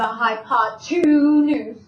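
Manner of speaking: "hypotenuse" with a long drawn-out TWO; two stressed inside word. Shown as a demonstration. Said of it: "I'm gonna say it that way".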